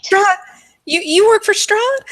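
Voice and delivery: high, loud stammering alarm; alarmed madwoman. Acted. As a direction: recoil at the dreaded name in a high voice. Cornered madwoman reacts to feared name.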